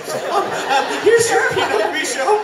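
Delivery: high, shaky-sounding nervous attendant; nervous flight attendant. Retold or imitated. Nervous flight attendant handing the wife her wine, high and trembling. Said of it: "like, shaking".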